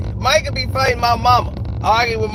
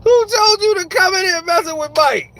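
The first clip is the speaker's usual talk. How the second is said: higher, louder scolding; mock scolding; 8 semitones higher, 4 dB louder than usual. Son scolding his mama for fighting Mike, mock scold.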